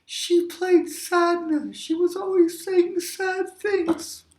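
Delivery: high, slow mock-sad wail; drawn-out, high sad voice; acted sadness. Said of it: "the woman that was really sad".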